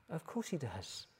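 put-on very soft child's reply; lightly voiced little girl, very quiet; little daughter. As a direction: answer in a small, hushed voice. Little daughter answers father's question.

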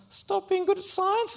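put-on high, meek kid repeating the order; squeaky echo. Scared boy meekly repeats the bully's words, high. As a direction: parrot it back up high and timid. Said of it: "And I'm like".

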